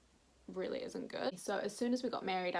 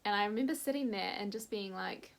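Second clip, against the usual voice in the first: normal talking voice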